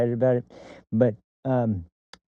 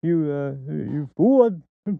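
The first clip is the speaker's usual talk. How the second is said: much higher, louder outraged put-on voice, slow on fool; indignant Al Bundy; 6 semitones higher, 4 dB louder than usual. Al Bundy protesting to the DMV clerk, outraged and loud. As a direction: sputter it out like an offended blowhard. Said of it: "then he goes".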